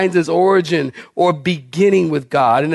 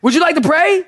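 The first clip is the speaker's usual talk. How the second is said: calling across room; 11 semitones higher, 3 dB louder than usual. Retold or imitated. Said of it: "I said".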